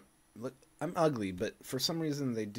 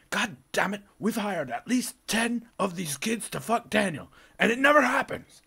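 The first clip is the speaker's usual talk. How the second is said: mock frustrated plotter; 10 semitones higher, 8 dB louder than usual. The internet plotters venting, a high, loud, frustrated rant. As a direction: fume that the plan keeps failing.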